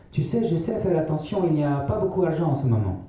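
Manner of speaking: grand-mère, voix bien plus aiguë et douce. A narrator's reading. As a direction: prendre la voix haute de la vieille grand-mère. (grand-mère qui répète sa maxime aux enfants, voix aiguë)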